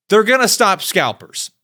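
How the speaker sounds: ordinary talk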